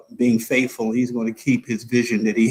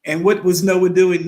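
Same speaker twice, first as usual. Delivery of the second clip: ordinary talk, nothing marked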